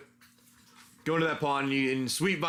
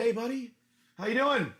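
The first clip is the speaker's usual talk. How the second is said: lightly voiced friendly ghoul, pitched higher; ghoulish villager; 7 semitones higher than usual. Ghoulish dude greets a wandering traveller, lightly voiced higher. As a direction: hail a stranger in a slightly raised voice. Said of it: "this ghoulish dude comes wandering up".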